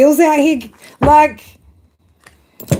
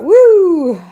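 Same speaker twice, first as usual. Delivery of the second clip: a higher, louder imitated woo; mock cheer; 6 semitones higher, 4 dB louder than usual. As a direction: whoop it like a giddy friend. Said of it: "You're like".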